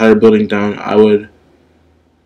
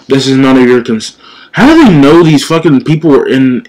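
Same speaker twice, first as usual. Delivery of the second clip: ordinary delivery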